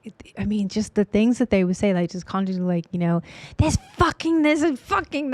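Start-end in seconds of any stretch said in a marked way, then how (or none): 3.6-5.3 mock angry client. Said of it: "just angry people".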